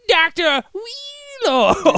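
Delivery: high, slow, loud drawn-out call; cartoon doctor shout. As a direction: announce him like a cartoon villain. Calling out a character name.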